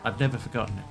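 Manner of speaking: ordinary talk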